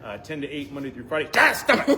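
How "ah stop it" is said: laughing outburst. Real, not acted. Laughing protest at cohost.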